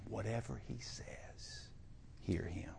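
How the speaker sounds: whispered urging to listen; soft whisper. Acted. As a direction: lean in and whisper it.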